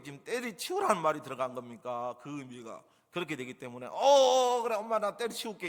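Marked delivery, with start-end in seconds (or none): 3.9-5.7 high, loud burst of naive agreement; hammed-up obliging kid; eager child agreeing. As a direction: answer Mom with exaggerated delight. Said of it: "이 얘기가 아니죠".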